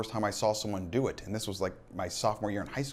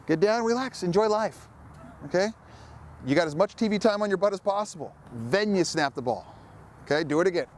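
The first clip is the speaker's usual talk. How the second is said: louder, higher coach voice carrying across the field; 10 semitones higher, 5 dB louder than usual. Real, not acted. Coach instructing young long snappers on the field, loud. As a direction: call out drills to the players.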